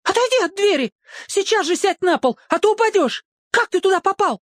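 high, scolding, panicked; mother ordering him down; frantic mother. A narrator's reading. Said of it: "бушевала внизу мама". (высокий встревоженный окрик)

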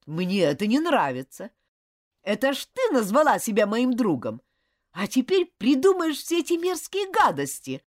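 loud, high, outraged protest; loud indignation. Acted. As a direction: protest loudly at her lies. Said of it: "громко возмутился Джулиан".

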